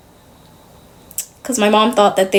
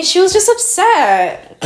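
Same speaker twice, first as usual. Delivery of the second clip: mom's excuse; 11 semitones higher, slower than usual. Retold or imitated. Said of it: "she didn't mean to".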